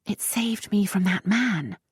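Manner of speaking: whispered, under her breath; defiant little whisper; muttered whisper. Acted. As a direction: mutter it sulkily, barely voiced. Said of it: "but muttered".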